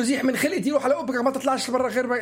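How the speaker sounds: high-pitched mock scolding of a child; dismissive parent. Parent shooing a crying child away, high-pitched dismissive scolding.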